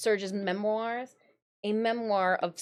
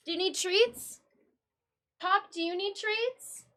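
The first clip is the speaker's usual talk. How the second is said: cat talk; 8 semitones higher than usual. Real, not acted. Owner offering cat treats, high baby voice. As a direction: coo it high to the pet.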